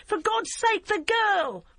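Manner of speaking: high, loud pleading shout; desperate shout. A narrator's reading. Barbara begs Emily to save the girl, high loud shout. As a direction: scream over the engine for the child. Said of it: "she shouted".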